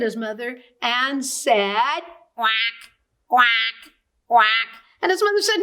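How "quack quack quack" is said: loud playful quack quack; puppy quacking instead of barking; duck quacks. A narrator's reading.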